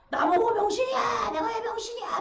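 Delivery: high, loud offended shout; angry yell. Acted. Blind man yelling at a stranger, furious. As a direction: scream back at the insult.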